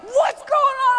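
high startled yell; shocked shout. Retold or imitated. Dad at the game jumping up, shouting in shock. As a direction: shout in alarm at the foul. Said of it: "Rand jumped up like".

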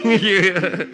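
can't stop laughing; laughing fit. Real, not acted.

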